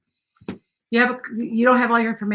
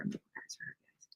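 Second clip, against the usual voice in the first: breathy whisper to yourself; 21 dB quieter than usual. Real, not acted. Streamer whispering a note to herself mid-stream. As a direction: mutter it under your breath, unvoiced.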